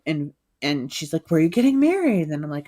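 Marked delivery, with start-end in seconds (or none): 1.3-2.2 higher, louder nosy excitement; imitated eager interest. Bank manager hears of the wedding, eager interest. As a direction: mimic an over-keen questioner. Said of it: "like, obsessed".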